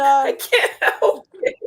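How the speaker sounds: helpless high laugh through the words. Real, not acted. Cohost excusing herself to Tasha, laughing.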